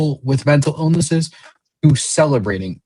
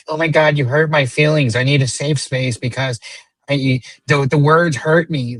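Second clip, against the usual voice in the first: higher-pitched mocking imitation of a hurt complainer; mock whiny complainer; 5 semitones higher than usual. Speaker mocking people who want safe spaces, mock-hurt complaint. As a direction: put on a high voice to ridicule the oversensitive.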